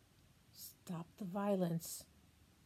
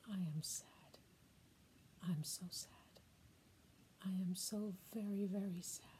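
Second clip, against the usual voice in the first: softer, sorrowful lament; a grieving grandmother's quieter sad refrain; sad grandma; 7 dB quieter, faster than usual. Acted. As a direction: sink into the sadness, almost spent. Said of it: "Message from a sad and mad grandma".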